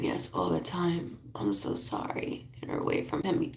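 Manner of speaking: soft unvoiced whisper, hushed; hushed, pleading whisper; urgent whisper. Real, not acted. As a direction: whisper it so only she hears. Medium whispering a private aside.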